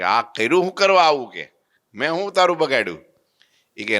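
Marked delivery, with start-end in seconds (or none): 0.0-1.3 higher and louder pained cry; yelling in pain. Retold or imitated. Branded preacher yells at his host, pained outcry. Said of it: "રાડ નાખી ગયો". 1.9-3.0 yelling. Retold or imitated. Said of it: "રાડ નાખી ગયો".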